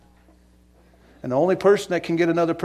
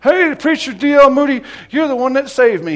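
put-on high, loud hailing of an old drunk; 11 semitones higher, 10 dB louder than usual. An old drunk hails preacher Moody in the street, loud drunken holler. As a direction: holler it out like a drunk greeting an idol. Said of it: "an old drunk came out stumbling".

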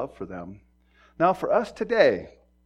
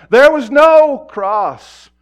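much louder and higher, slow, each word struck; emphatic preaching; 10 semitones higher, 18 dB louder, much slower than usual. Acted. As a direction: drive it home from the pulpit. Preacher hammering the point.

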